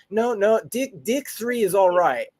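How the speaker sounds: "deck" as "deek", in a high voice; New Zealand DECK. Retold or imitated. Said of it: "They say deck differently".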